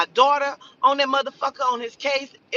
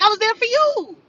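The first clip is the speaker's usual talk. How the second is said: higher, louder sarcastic parroting of his line; mocking echo; 9 semitones higher, 5 dB louder than usual. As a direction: throw "there for you" back at him with scorn.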